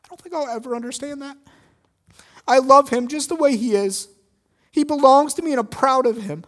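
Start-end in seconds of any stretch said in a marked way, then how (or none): 2.5-6.4 loud, high, firm declaration; raised, earnest voice of pride; proud defender. Acted. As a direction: stand up for the boy before the crowd. Man defending the boy aloud.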